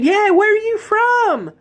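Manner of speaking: very high, slow, twangy Tennessee question; puzzled girl in a thick Tennessee accent; mountain Tennessee accent. Retold or imitated. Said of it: "born and bred Tennessean from the mountains".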